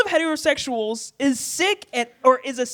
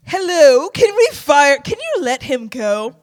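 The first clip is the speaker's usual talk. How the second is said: mock demanding customer; 3 semitones higher, 7 dB louder than usual. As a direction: demand it like a diva. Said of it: "to be like".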